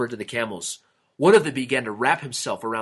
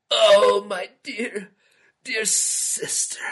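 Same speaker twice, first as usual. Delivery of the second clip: high, breaking sob that fades to breath; crying; 10 semitones higher, much slower than usual. A narrator's reading. King weeps over his sister, high sobbing cry. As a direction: weep over the dead sister. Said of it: "he cried".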